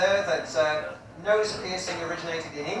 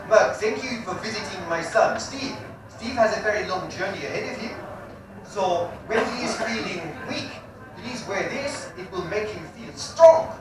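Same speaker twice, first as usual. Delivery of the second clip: put-on earnest South African accent, the A vowels pushed toward E; solemn South African mum thanking him; a grateful Zulu mother in a South African accent. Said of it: "let me get into character".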